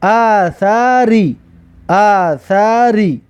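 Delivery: very slow, drawn-out syllables; slow teacher's model reading. Shown as a demonstration. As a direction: stretch each syllable for learners to copy. Teacher models the blended word.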